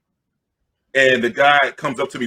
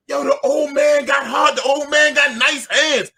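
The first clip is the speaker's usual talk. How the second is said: high, slow hyped shout; crowd yelling; 9 semitones higher, slower than usual. Retold or imitated. Teenagers cheering the old man on, high slow shout. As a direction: holler it like teens egging on a fight. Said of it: "So now the young people are yelling".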